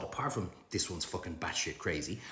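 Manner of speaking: ordinary talk, nothing marked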